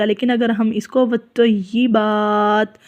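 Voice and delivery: ordinary talk, nothing marked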